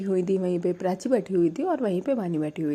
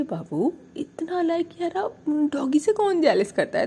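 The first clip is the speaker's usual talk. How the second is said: cutesy coo; 8 semitones higher than usual. Retold or imitated. Girlfriend coos at jealous partner.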